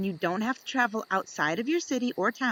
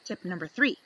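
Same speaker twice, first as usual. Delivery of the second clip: ordinary delivery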